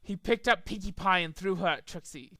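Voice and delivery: ordinary delivery, nothing marked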